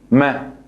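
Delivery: short clear me with fatha; Arabic syllable me. Shown as a demonstration. Teacher modelling fatha vowel, short clear me. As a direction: say me short, one beat. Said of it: "pronunciando eso como me".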